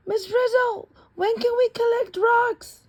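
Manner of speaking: put-on high child's voice asking; high kid voice pestering the teacher; eager kid. A narrator's reading. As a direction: pipe up like an impatient kid.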